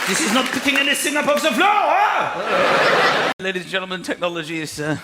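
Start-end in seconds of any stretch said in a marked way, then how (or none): 0.1-3.3 frustrated Frenchman at a dead hoover; baffled French hoovering. Retold or imitated.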